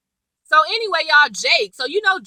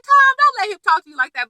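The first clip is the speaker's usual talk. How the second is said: pleading at TV; 6 semitones higher, 3 dB louder than usual. Retold or imitated. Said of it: "I was just like".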